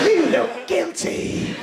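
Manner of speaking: high, loud, drawn-out accusation; slow, high-pitched taunt; accusing captain. Acted. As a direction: raise the pitch and stretch out the charge. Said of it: "The captain is going".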